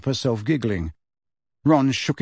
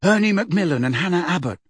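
quick, higher, thick mumbled answer; thick-voiced; 5 semitones higher, faster than usual. A narrator's reading. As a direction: talk thickly through a mouthful. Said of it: "said Ron thickly".

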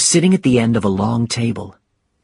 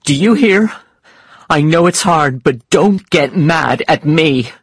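higher, louder scolding; wife's heated, pitched-up telling-off; indignant cry; 6 semitones higher, 5 dB louder than usual. Acted. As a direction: scold your husband with raised voice. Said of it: "she cried".

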